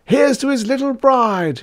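very high, rowdy toast; roaring roisterers. A narrator's reading. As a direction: cheer it like a merry drinking crowd. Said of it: "roared the roisterers with shouts of laughter".

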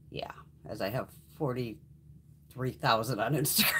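slow whispered brag with a laugh; whispered aside. Real, not acted. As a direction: let it slip out like a secret, laughing. Smug aside about follower count.

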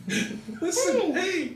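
laughing high squeal of listen; high fairy voice. Retold or imitated. Navi impression laughing.

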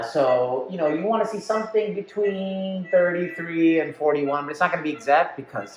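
muffled behind respirator; speaking into sealed mask; through a face mask. Real, not acted.

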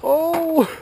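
high, loud yelp into laughter; surprised laugh. Real, not acted. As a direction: cry out then burst out laughing. RC car crashes on a jump.

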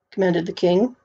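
usual speaking voice

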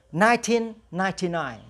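"Nineteen ninety-nine" said as two pairs, nineteen then ninety-nine; read in pairs. Shown as a demonstration. Teacher shows students how to read the year in pairs. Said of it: "đọc theo đôi cái số này".

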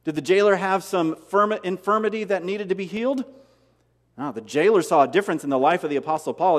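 ordinary delivery

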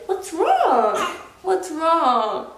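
high, drawn-out cooing question. Real, not acted. Mother checks on her fussing baby, high baby talk.

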